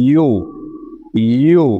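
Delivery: "ইউ" with the weight on the উ; English U with the force on উ; stressed U. Shown as a demonstration. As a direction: push the উ of "ইউ". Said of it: "pressure বা ফোর্সটা পড়বে হচ্ছে উ এর উপরে".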